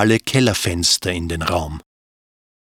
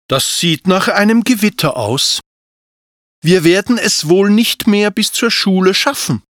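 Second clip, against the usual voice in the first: put-on higher, worried little boy voice; young dragon boy Anton; 10 semitones higher than usual. A narrator's reading.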